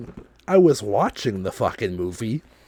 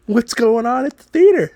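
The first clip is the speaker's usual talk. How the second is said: much higher, louder exasperated outburst; retold outrage; 14 semitones higher, 7 dB louder than usual. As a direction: blurt it like the lights just came back on. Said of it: "So I was like".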